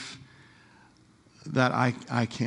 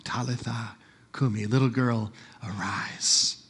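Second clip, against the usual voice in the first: soft, lower, tender call; soft tender command; 3 semitones lower, 3 dB quieter than usual. Acted. Jesus calling the little girl back to life, tender.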